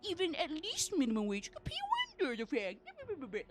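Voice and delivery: mocking employer voice. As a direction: mimic a smug employer. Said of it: "you guys saying, like".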